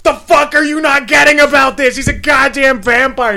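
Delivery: exasperated high, loud yelling; Charlie. Acted. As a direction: shout in disbelief at someone who won't listen. Charlie arguing with mom while driving.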